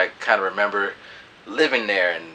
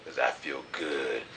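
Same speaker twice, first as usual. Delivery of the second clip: much lower and softer, slowing at the end; low smooth reply; 7 semitones lower, 10 dB quieter than usual. Retold or imitated. Offering the girl a massage, low and soft. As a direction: lay on a low, smooth charm. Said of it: "and like".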